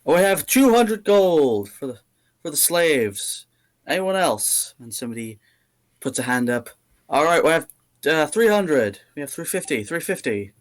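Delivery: milk each bid like an auctioneer; auctioneer calling bids. Acted. The half-orc auctioneer calls out rising bids, loud.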